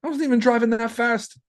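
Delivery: high-pitched mock whine to the rental guy; mock protest. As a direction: squeak it out like a wronged innocent. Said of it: "I was like".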